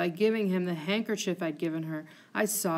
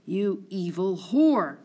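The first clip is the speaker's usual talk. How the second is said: slow, louder hostile threat; slow menace; 4 dB louder, slower than usual. Acted. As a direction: spit out each word slowly. Othello turns on Emilia.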